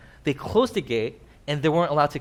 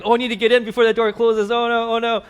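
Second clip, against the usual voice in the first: higher, louder hammed-up panic; mock panic; 7 semitones higher, 4 dB louder than usual. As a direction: fake a frantic rush to get in. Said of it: "are like".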